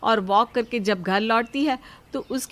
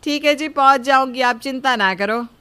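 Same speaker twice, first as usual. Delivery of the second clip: loud, higher reply to husband; calling back agreeably; 5 semitones higher, 7 dB louder than usual. Acted. Wife agreeing to go to the prayer, calling back loudly. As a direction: call out a reassurance, raised and high.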